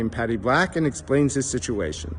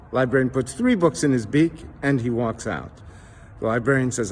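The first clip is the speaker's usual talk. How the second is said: ordinary talk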